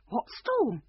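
worried, high-pitched question; anxious king, voice jumping up. A narrator's reading. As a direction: ask it in high-voiced alarm. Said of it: "looking round worriedly".

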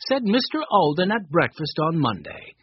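ordinary delivery, nothing marked

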